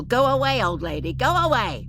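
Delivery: high, loud, flat quack; quacking doll. A narrator's reading. Doll closes in on Miss Harper, quacking. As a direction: squawk it insistently in a high doll voice. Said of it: "quacked the doll".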